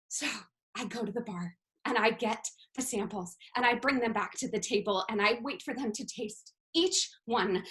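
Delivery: ordinary delivery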